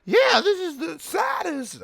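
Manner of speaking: put-on very high, slow squeaky baby voice; baby Knuckles voice. A narrator's reading. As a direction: squeak like a tiny toddler.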